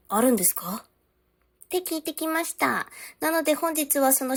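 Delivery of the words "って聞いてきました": ordinary delivery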